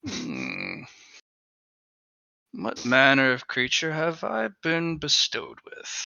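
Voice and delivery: a bored ancient thing sizing you up; creature voice. Acted. Mask creature speaks to character.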